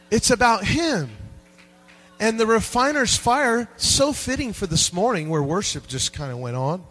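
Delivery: loud, high, driving sermon voice; full-throated revival preaching; preaching at full force. Acted. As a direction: preach it to the back row.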